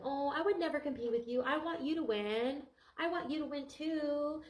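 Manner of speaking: high, slow sugary fake-friend voice. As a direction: sound sweet while hiding jealousy. Fake friend voiced, feigned sweetness.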